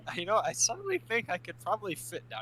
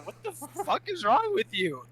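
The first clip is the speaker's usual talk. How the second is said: high, loud, drawn-out indignant yell; outraged shout; 10 semitones higher, 7 dB louder than usual. Acted. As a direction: yell it slowly in acted outrage. Player character outraged at being pushed.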